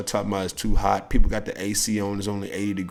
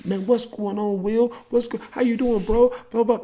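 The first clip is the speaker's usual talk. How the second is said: high, glad hello; bright high welcome; happy greeting; 13 semitones higher than usual. Retold or imitated. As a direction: greet him warmly and eagerly. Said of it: "they love see you coming".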